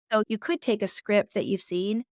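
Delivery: ordinary talk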